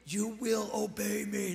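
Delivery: slow, drawn-out mock demonic command; imitated demonic scream. Preacher retelling how a street attacker screamed at him, mock demonic scream. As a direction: imitate a possessed man screaming, without really shouting. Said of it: "That's what he starts screaming".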